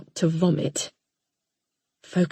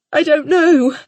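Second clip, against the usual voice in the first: higher, louder tearful reply; 8 semitones higher, 8 dB louder than usual. Acted. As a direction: she answers through tears. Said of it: "tears running down my cheeks".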